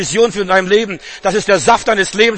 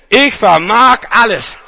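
louder, slow and emphatic; slow proclamation; 4 semitones higher, 5 dB louder, slower than usual. Acted. Preacher hammers the verse home slowly and loudly. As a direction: lean on each word, proclaim it.

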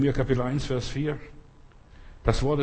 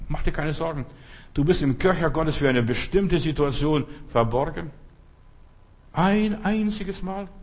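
normal talking voice

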